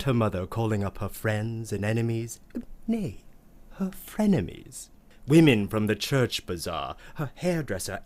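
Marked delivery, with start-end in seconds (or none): none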